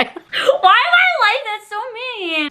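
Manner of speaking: high, whiny mock cry; mock wail. Woman scolding herself over an unanswered text, mock wail. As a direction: wail it in playful self-reproach.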